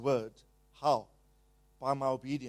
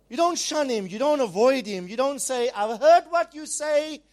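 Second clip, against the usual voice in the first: preaching at full force; 8 semitones higher, 8 dB louder than usual. Real, not acted. Preaching climax against refusing God.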